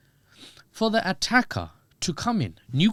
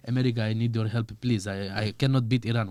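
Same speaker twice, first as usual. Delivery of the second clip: put-on lower, pleading in an Eastern European accent; 5 semitones lower than usual. Netanyahu begs America for help, Eastern European accent. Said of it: "giving him an Eastern European accent".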